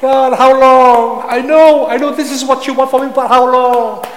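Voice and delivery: the psalmist's loud cry of how long; pleading cry. Acted. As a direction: plead with God, desperate. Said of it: "One of the cry of the psalmist".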